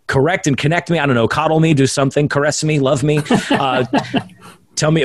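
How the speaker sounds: ordinary talk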